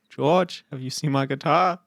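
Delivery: put-on lilting Liverpool accent, calling to a bandmate. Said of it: "I just started doing the Beatles".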